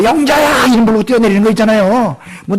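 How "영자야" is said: higher shouted name call. Retold or imitated. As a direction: yell the name as you jump. Calling a name while jumping.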